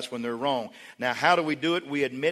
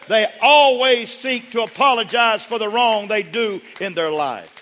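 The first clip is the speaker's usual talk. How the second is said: high, loud, building and slowing for weight; impassioned preaching; 8 semitones higher, 8 dB louder than usual. Real, not acted. Preacher teaching when to ask forgiveness, at full force. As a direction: hammer each point to a ringing climax.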